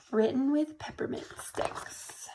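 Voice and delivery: slow soft whisper. Acted. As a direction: whisper it slowly like a secret. Unvoiced reading of page text.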